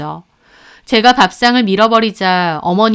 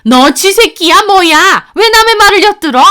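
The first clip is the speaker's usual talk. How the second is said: loud, high mother-in-law outburst; indignant yell, pitch shooting up; outraged scolding; 7 semitones higher, 6 dB louder than usual. Acted. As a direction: jump down the eavesdropper's throat. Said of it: "어머님이 더 펄쩍 뛰셨죠".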